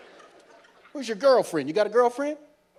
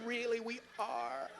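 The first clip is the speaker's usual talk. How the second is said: mock sincere; 2 semitones higher, 7 dB quieter, slower than usual. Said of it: "And people going".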